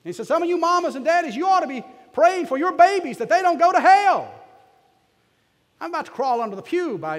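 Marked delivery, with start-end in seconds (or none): 0.3-4.3 high, loud country preacher's cry; preaching shout. Retold or imitated. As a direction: belt it out like a mountain lay preacher. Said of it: "I don't suppose I should preach like that here".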